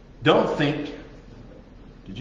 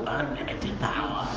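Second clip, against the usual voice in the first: Emperor quote; 9 dB quieter than usual. Retold or imitated. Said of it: "I want to quote the Emperor here from Star Wars".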